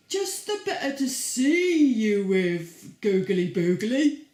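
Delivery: a loud, sly wolf in disguise. Acted. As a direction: boom it out like a sneaky wolf. Wolf voiced in the story.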